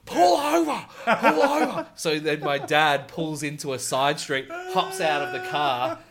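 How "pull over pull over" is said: high, laughing yell; dad demanding the driver pull over. Retold or imitated. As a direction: bark it high through laughter. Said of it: "did the full".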